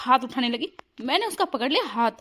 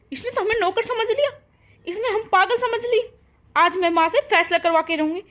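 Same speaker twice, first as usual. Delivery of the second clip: much higher, indignant outburst; outraged; 8 semitones higher than usual. Acted. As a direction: burst out in disbelief at the insult. Outraged complaint about daughter-in-law.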